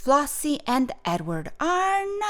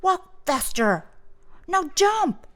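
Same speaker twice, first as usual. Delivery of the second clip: higher, punched commands; bossy; 5 semitones higher, 4 dB louder than usual. A narrator's reading. Boy ordering a girl around, bossy. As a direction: drill them with insistent orders. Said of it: "Edward was even bossy to Flossie".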